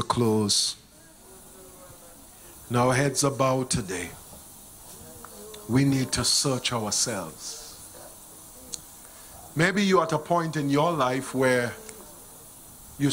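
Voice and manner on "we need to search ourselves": usual speaking voice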